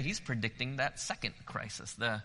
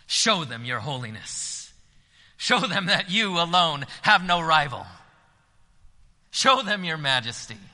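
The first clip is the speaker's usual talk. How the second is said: fervent prayer; 4 semitones higher, 8 dB louder than usual. Acted. Hezekiah asking God for deliverance, fervent prayer. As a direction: call on God with growing fervor.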